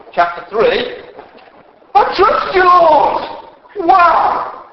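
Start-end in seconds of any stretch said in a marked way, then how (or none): 1.9-3.9 joyful trust. Retold or imitated. Habakkuk's final trust. 3.9-4.6 excited wow. Real, not acted. Exclaiming at the turnaround.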